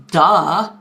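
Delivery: loud, punched "isn't it obvious"; mock duh. Daughter answering dad about the tattoo, mock duh. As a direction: hit it hard like a teasing know-it-all.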